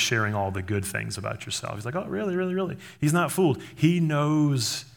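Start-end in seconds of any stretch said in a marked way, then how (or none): 2.0-2.8 high, feigned-fascinated listener; high-pitched pretend surprise; mock interest. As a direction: play along as if hearing news. Acting someone feigning interest in you.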